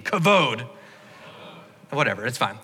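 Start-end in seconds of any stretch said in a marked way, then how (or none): 0.0-0.7 "Kavod" said with weight and meaning. Shown as a demonstration. Preacher modelling the Hebrew word for the congregation, with meaning. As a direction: give "Kavod" real significance. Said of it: "now say it with meaning".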